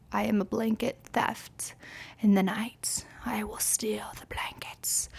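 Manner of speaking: hushed, whispered confession; mock-sinister whisper. Blanket thief confessing to night-time crimes, whispered. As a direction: whisper it slowly towards the end, like a secret.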